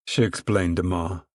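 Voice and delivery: usual speaking voice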